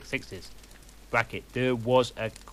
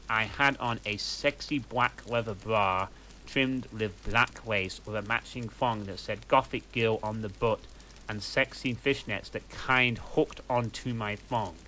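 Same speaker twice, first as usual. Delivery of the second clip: plain reading voice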